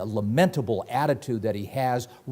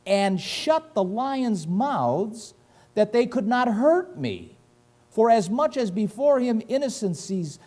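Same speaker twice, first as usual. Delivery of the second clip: preaching; 8 semitones higher, slower than usual. Acted. Reads Daniel's words preached.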